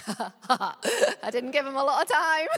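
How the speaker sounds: real laughter running through the words; laughing at herself. Real, not acted. Preacher laughs at her own short deadline for God, laughing. Joke about rushing God.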